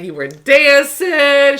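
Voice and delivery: high, loud, drawn-out revelation; mock exasperation. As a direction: stretch it out as a hammed-up accusation. Acted friend reporting last night.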